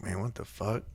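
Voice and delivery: retelling a deputy alone in the woods, hushed and baffled; hushed disbelief. Retold or imitated. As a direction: murmur it under your breath, baffled. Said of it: "And he's like".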